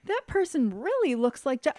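high squeal building in disbelief; imitated astonishment. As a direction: act out shocked recognition, climbing very high. Aunt recognizes her niece, acted.

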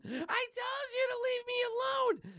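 very high, shouted; screaming girl. Acted. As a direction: scream it at them. Girl lashes out.

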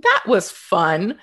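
slow, loud, drawn-out sarcasm; sarcastic. Real, not acted. Woman telling interviewer how the pandemic derailed recovery, sarcastic. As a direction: draw it out slowly with heavy sarcasm. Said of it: "she says, completely sarcastically".

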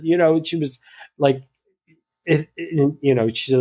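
ordinary delivery

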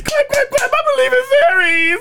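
high, desperate yelling chant; shouted plea. Pretend. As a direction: beg the fairies to live, shouting. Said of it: "From Peter Pan".

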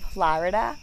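FLORIDA with a first vowel like "ah"; Boston pronunciation. Shown as a demonstration. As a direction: say Florida the Boston way, "Flah-rida". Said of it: "You pronounce it the right way. If you were from Boston".

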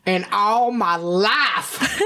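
slow, drawn-out lament; hammed-up longing; mock dramatic. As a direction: stretch it out like a confession. Dramatic echo of craving.

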